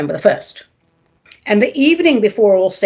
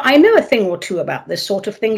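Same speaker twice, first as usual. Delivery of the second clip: ordinary talk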